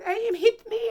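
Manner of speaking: high, loud tearful yell; crying accusation. Retold or imitated. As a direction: wail it to the police. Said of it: "the woman start".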